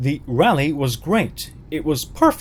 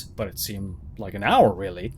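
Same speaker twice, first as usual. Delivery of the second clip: plain reading voice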